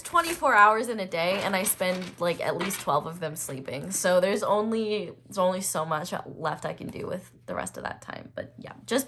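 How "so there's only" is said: usual speaking voice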